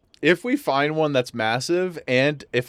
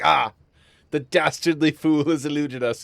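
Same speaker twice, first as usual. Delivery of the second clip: mock villain; 3 semitones higher, 3 dB louder than usual. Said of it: "this guy's like".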